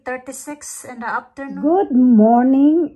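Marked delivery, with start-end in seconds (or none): 1.6-2.9 loud greeting. Real, not acted. Cheery vlog greeting to viewers.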